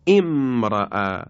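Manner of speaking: امرأ said as "emra'", opening with a kasra vowel; kasra start. Shown as a demonstration. Teacher shows امرأ to students, slow careful kasra opening. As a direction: start امرأ on an e sound, slowly. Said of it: "با کسره آغاز بشه".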